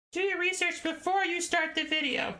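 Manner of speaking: very high squeaky nagging voice; mock scolding voice. Creator scolding himself in a high squeaky voice. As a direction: scold yourself in a shrill falsetto.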